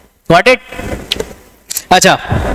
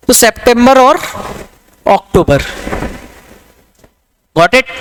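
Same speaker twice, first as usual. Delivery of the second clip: ordinary talk, nothing marked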